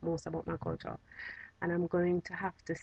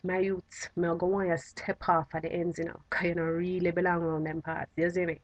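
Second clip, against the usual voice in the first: easy Jamaican patois warning, half-joking. Shown as a demonstration. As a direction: drop into casual Jamaican patois to shoo someone off. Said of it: "say something now in Jamaican dialect".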